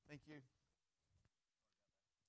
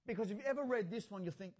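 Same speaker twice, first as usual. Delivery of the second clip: ordinary talk, nothing marked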